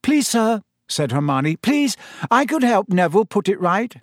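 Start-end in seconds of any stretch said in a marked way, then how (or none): 0.0-0.6 high-pitched, eager schoolgirl plea; pleading. A narrator's reading. Pupil asking to help a classmate, high pleading. 1.6-3.9 high-pitched, eager schoolgirl plea; pleading. A narrator's reading. Pupil asking to help a classmate, high pleading.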